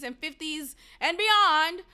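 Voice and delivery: ordinary talk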